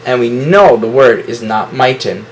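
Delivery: ordinary talk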